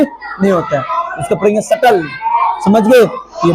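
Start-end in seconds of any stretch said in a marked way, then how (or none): 1.6-2.0 "सटल" with no b; silent b. Shown as a demonstration. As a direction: go straight to t in "सटल". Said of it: "बी का उच्चारण नहीं होता".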